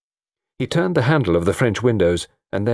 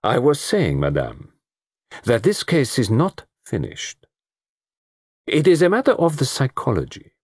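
a hint of a French-tinged detective, measured; Poirot explaining the psychology to Madame; Poirot's voice; 4 semitones higher than usual. A narrator's reading. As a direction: lecture them with calm certainty.

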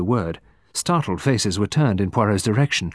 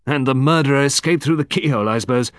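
quick, higher-pitched scoffing colonel; sceptical; 5 semitones higher, 5 dB louder, faster than usual. A narrator's reading. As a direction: toss it off in brisk disbelief. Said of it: "said Colonel Berry sceptically".

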